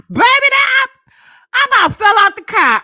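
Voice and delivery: real high, loud whoop of hilarity; hollering in amusement. Real, not acted. Woman telling followers about the cussing cashier, hollering high. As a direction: shout it out, cracking up at the story.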